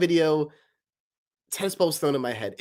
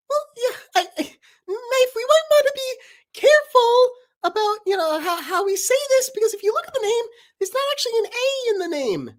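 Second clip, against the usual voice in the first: very high fussy lecturing; Paper's voice; 5 dB louder, faster than usual. Acted. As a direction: nitpick in a squeaky voice. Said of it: "Paper and Knife talking".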